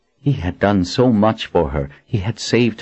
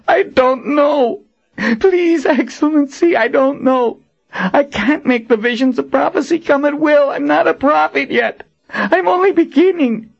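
agonized begging; 3 dB louder than usual. Acted. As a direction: beg through searing pain. Warren in agony begging.

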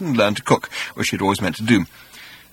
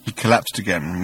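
usual speaking voice

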